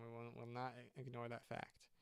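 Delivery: ordinary talk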